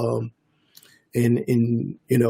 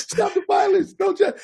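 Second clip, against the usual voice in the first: put-on starstruck young kid. Armed youths recognizing the producer, high starstruck voice. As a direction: squeak it up like a kid meeting his idol.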